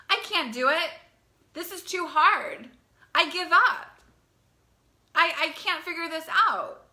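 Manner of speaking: imitating a frustrated girl, her pitch climbing to a cry of giving up; overwhelmed girl. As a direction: act out a student giving up. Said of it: "girls and young women who say".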